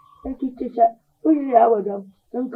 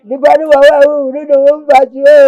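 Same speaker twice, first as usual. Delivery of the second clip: loud lament; 8 dB louder than usual. Acted. Mother's rising loud lament.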